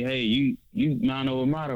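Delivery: ordinary delivery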